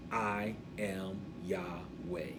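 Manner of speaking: low, soft and very slow; slow, gentle, weighty vow; tender declaration. Acted. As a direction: say it like a hushed "I love you". Tender quote likened to I love you.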